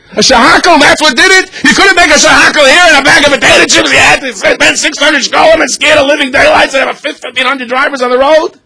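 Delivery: mock the idea in high outrage; incredulous wife. Wife scoffing at her husband's shahakal story, high incredulous outburst.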